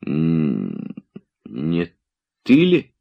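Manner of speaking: halting, frightened question, voice jumping up at the end. A narrator's reading. Oblomov asks his friend in sudden fright, stammering. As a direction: stammer it out in fear. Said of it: "вдруг спросил он".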